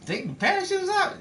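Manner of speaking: high incredulous question; stunned, can't believe the order; shocked disbelief. Acted.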